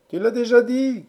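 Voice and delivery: high, loud crowd interruption; shouted heckle. A narrator's reading. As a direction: shout the speaker down. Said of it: "cria le chœur". (cri aigu d'un chœur qui interrompt)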